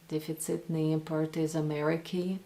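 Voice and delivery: импорт and Америки with English R's; Russian line with untrilled English R in импорт, Америки. Retold or imitated. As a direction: imitate an American-sounding R in импорт and Америки. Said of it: "Despite those really English R's, like".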